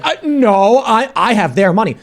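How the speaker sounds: high, loud mock protest; mock indignant comeback. Acting out their indignant comeback.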